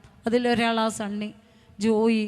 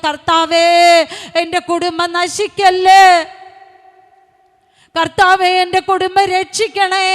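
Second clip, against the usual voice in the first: loud, high imitation of a mother crying out to God; tearful pleading prayer; 6 semitones higher, 9 dB louder than usual. Preacher voicing mother's night prayer for her children, loud tearful pleading. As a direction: cry out a desperate prayer, stretching the pleas. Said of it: "എൻ്റെ അമ്മ കരയുമ്പോൾ പ്രാർത്ഥിക്കുന്ന വാക്കുകൾ ഇതാ".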